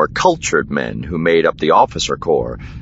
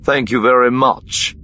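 irritated, pitched-up sarcasm; growled thanks; 7 semitones higher than usual. A narrator's reading. Korran stung by Miranda's jibe, growling thanks. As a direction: give pointed thanks with a mild growl. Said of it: "Korran growled".

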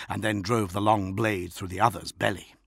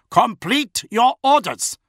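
high, loud, slow order; forceful command; 14 semitones higher, 8 dB louder, slower than usual. A narrator's reading. Bodyguard commanding the officer he is choking, forceful. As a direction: drive each word home.

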